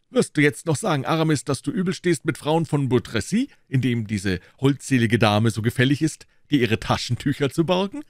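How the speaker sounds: a bystander needling a comrade; taunting bystander. A narrator's reading. Bystander teasing Aramis over the lady's handkerchief, taunting.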